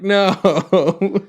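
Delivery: hammed-up groan of dread, half laughing; mock dismay. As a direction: wail it in mock despair. Retold dismay at captcha boxes.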